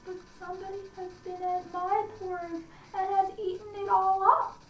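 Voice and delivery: put-on squeaky, upset baby bear; little wee bear. A narrator's reading. Narrator voicing the wee bear finding his porridge eaten, high. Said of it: "in his little small wee voice".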